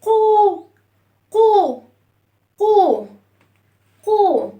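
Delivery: "قو" held as a long oo, loud; rounded damma sound. Shown as a demonstration. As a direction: round the lips tightly on "قو". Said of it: "هنضم الصوت".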